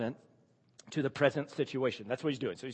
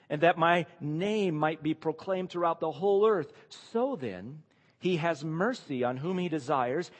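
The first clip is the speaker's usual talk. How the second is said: raised, measured Bible reading voice; scripture reading; 3 semitones higher, 3 dB louder than usual. Acted. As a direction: read the passage out to the congregation. Reads scripture passage aloud.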